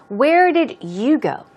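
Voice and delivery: "you" stressed in a slow question; where did YOU go, drawn out. Shown as a demonstration. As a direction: slowly, lean hard on the word "you". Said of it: "so the stress is on you".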